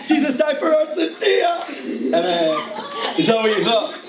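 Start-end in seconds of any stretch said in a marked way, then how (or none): 0.0-1.6 high, shouted mock slam poetry; Jump Street slam poet. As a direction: cry it out like an overwrought slam poet. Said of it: "you might picture twenty-two Jump Street".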